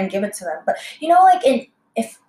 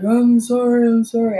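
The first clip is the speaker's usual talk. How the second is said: higher, louder mock plea; pleading apology; 4 semitones higher, 5 dB louder than usual. Man following waitress back into restaurant, apologising urgently. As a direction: imitate frantic repeated apologising, pitched up.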